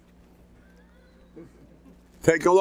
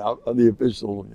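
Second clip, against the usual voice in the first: ordinary talk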